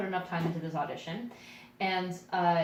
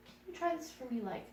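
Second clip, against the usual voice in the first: higher-pitched imitation of a tactful request; gently ask, with hesitant pauses; careful retold direction from the writer; polite casting writer; 5 semitones higher than usual.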